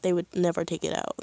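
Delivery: normal talking voice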